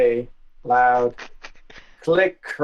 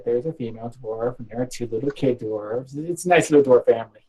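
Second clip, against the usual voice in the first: usual speaking voice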